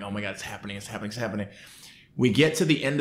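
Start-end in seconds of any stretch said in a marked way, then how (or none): 0.0-1.5 beaming excitement. Retold or imitated.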